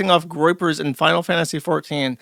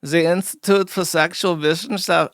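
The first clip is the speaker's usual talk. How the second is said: normal talking voice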